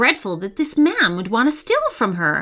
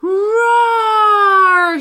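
loud drawn-out roar; werewolf roar; 10 semitones higher, 6 dB louder than usual. A narrator's reading. Werewolf roaring at litterbugs, loud long roar. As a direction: let out a big monster roar. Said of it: "she roared".

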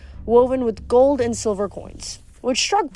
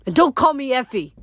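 raised-pitch, forceful order; indignant demand; 5 semitones higher, 4 dB louder than usual. A narrator's reading. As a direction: forbid the nickname with irritation. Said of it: "Effie demanded".

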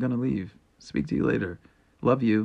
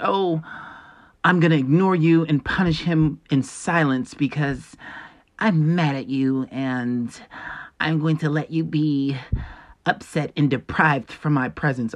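slow, lightly voiced mock of a sulking partner; 3 semitones higher, slower than usual. Mocking an upset partner who punishes with silence, slow and petty. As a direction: drag it out like a petty sulker. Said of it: "the whole point of them being mad is to".

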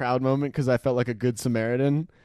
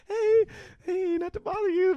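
sweet, high, overly polite; mock-polite high voice. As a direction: act falsely nice and meek. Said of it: "I'm just like".

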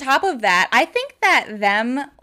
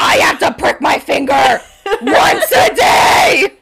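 louder, higher, drawn-out mock wail; hammed-up wailing shout; 4 semitones higher, 7 dB louder, slower than usual. Cohost mocking the diabetic character, in a drawn-out mock wail. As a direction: wail it dramatically, dragging the end. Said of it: "acted diabetic rant".

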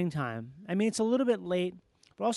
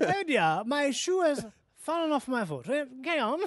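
put-on tipsy posh British accent, higher and slowed; drunken posh British accent; 3 semitones higher than usual. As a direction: slur it like a tipsy English squire. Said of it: "British guy that announces to a room that his shoe fell off".

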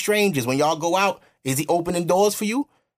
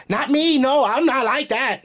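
high, drawn-out mock objection; higher-pitched imitation of indignant denial; mock defensive protest; 7 semitones higher, slower than usual. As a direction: imitate someone protesting too much. Mimics women defending themselves online.

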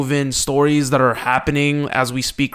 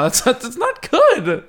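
high, laughing through the words; 9 semitones higher, slower than usual. Real, not acted. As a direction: giggle it out, can't keep a straight face. Laughing at bad name idea.

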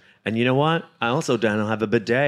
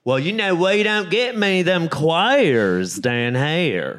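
slow, higher Southern drawl with a laugh in it; Southern drawl impression; 4 semitones higher than usual. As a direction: ham up a Southern local sneering at queers. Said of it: "But in the South, it's very much like".

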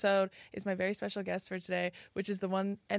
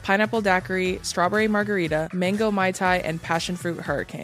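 ad read; 5 dB louder than usual. Acted. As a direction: sell it like a radio ad. Sponsor ad read.

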